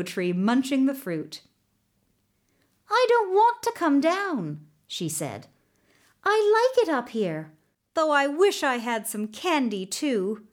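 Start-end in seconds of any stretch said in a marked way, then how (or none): 2.9-4.7 put-on high childish protest; little girl. Girl in the mango tree refusing, high and childish. 6.2-10.5 put-on high playful child voice; little girl. Girl in the mango tree asking for candy, high and light.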